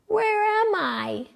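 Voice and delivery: high, slow, whispered and groggy; barely awake, whisper it; sleepy Joe. A narrator's reading. Boy just waking asks his mother, drowsy whisper. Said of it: "Joe asked".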